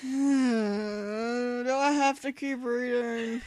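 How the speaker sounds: slow, drawn-out weary plea; weary whine. Real, not acted. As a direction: beg to stop, exhausted. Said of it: "weary plea to stop".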